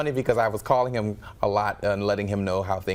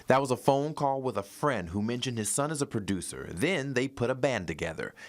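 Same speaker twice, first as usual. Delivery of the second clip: steady, polished newscaster delivery; news read; 2 semitones higher, 5 dB quieter than usual. Acted. Anchor introducing a local musician's story, news read.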